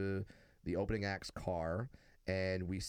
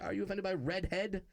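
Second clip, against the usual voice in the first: normal talking voice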